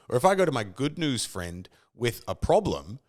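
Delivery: usual speaking voice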